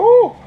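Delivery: high, loud "woo" whoop; excited scream. Retold or imitated. Moviegoer reacting to the hero's entrance, excited high whoop. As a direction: let out a piercing theater whoop. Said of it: "I almost screamed in the theater".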